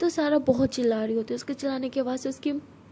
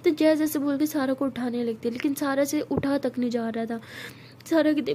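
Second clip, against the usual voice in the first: ordinary delivery, nothing marked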